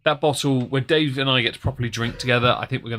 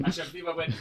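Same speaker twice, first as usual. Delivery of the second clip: normal talking voice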